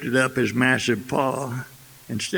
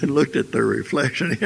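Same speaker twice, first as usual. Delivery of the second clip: ordinary delivery, nothing marked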